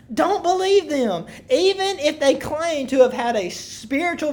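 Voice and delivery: preacher proclaiming scripture; emphatic scripture reading. Acted. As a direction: read the verse out like an urgent warning. Reads scripture aloud emphatically to congregation.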